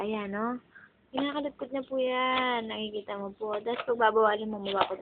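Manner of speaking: soft, low commentary; hushed narration. Real, not acted. A mother quietly narrating her baby chewing the bike, soft and low.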